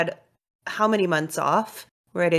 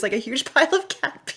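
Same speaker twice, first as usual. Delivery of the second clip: ordinary delivery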